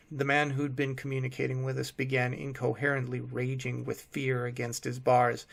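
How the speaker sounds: ordinary delivery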